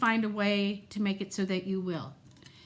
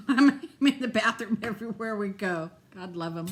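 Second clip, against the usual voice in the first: ordinary talk, nothing marked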